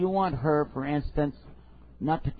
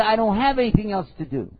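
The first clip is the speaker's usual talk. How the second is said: higher, louder complaining wife; wife pleading, higher and louder; whining wife; 6 semitones higher, 6 dB louder than usual. Acted. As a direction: protest like a bored wife. Wife protests in acted quarrel.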